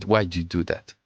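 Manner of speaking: ordinary delivery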